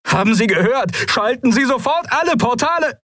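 high, frantic repeated command; shrieked order. A narrator's reading. Galet repeats his order into the cube, shrieking. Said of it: "kreischte Galet über die Sirenen hinweg".

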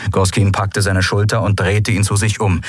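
ordinary delivery, nothing marked

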